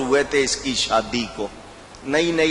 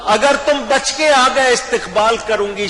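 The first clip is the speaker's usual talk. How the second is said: put-on brave young wife; 8 semitones higher, 3 dB louder than usual. Wife voiced by preacher.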